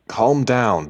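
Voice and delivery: insistent, alert calming-down; urging calm. A narrator's reading. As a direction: tell them to settle down, urgently. Husband tries to settle screaming wife.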